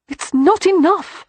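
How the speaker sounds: high, loud outburst, slow on each word; protesting cry. A narrator's reading. Beatrix crying out against Christopher's offer, loud protest. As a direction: burst out with it, refusing to settle.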